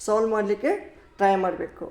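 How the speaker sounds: ordinary talk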